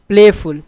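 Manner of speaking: Playful with a loud play and lighter फुल; stress on first syllable. Shown as a demonstration. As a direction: punch play, let फुल drop. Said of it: "playful पे इतना जोर नहीं है".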